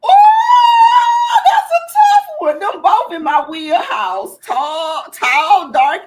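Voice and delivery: high, loud excited squeal, then laughing; real excited squeal. Real, not acted. Guest reacting to the host's crush question with an excited squeal. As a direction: squeal with delight at two fine men. Tough celebrity crush choice.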